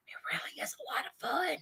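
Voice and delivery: quick, very soft whisper; confiding whisper. Real, not acted. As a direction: whisper it like a guilty secret. Confides her guilty pleasure.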